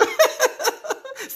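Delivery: high burst of real laughter. Real, not acted. Reading a funny comment.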